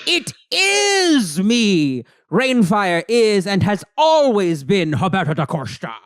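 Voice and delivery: slow, loud dramatic villain voice; booming villain reveal. Retold or imitated. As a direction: boom it out like a comic book villain. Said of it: "creepy, booming voice".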